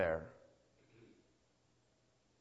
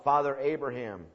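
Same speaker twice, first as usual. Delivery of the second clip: slightly louder, higher plea, slowing; half-raised pleading cry; mild acted yell; 4 semitones higher, 6 dB louder than usual. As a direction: call out for mercy without a full shout. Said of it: "And he yells out".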